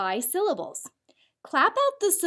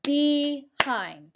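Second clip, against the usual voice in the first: said slowly, split into its two beats be-hind; "Behind" clapped out slowly, one beat per syllable; 3 semitones higher than usual. Shown as a demonstration. Teacher claps the word with her class, slow syllables. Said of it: "Let's do it together".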